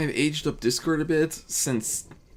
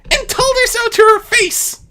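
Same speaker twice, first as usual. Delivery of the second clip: put-on much higher, louder young trickster voice; Discord as a boastful youth; 9 dB louder than usual. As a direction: brag it like a mischievous young god. Discord's voice, held.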